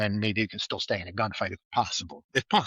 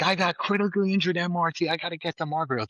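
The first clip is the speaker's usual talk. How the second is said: raised, higher-pitched urgent call over the rotor noise; urgent pilot call; 7 semitones higher, faster than usual. Retold or imitated. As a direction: shout it like a pilot radioing in an emergency. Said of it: "he's like".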